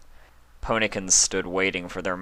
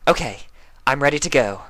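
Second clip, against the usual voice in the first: Rarity voice; 7 semitones higher, 5 dB louder, faster than usual. A narrator's reading. Rarity ready.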